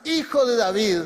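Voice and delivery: loud, projected call. Acted. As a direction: push the voice out, insistent. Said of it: "se puso a gritar aún más".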